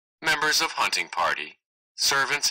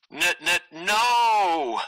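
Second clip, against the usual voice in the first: stammered no; 9 semitones higher, much slower than usual. Acted. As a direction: stammer then wail no as the arrow falls. Prince sees arrow dropped.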